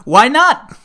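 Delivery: very loud, high hammy comeback; classic line delivery. Acted. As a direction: shout it back like a movie villain. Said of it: "classic line delivery".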